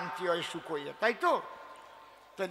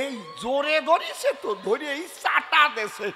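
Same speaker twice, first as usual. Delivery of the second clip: excited story climax; 4 semitones higher, 3 dB louder than usual. Acted. Climax of comic story, shouting.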